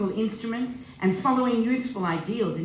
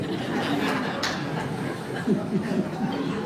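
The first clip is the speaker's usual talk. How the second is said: breathy chuckle at the joke; short laugh. Real, not acted. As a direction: laugh lightly at your own punchline. Laughs after own joke.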